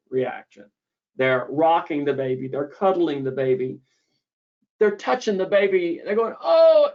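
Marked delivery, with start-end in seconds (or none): 6.4-6.9 a high, loud delighted "oh"; delighted coo. Retold or imitated. Mom cooing at her baby.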